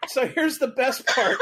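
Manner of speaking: high, slow words through laughter; laughing. Real, not acted. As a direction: savor the best part while still laughing. Teller recovering from laughing fit.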